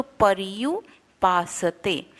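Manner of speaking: पर्युपासते slow, r sounded before य. Shown as a demonstration. Teacher demonstrating the reph in पर्युपासते. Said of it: "उसके पहले हम र का उच्चारण करते हैं".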